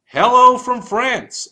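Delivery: loud, high, drawn-out hello; big sing-out greeting from abroad; exuberant greeting. Acted. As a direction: call out the postcard greeting slowly and high. Reads out client's postcard greeting.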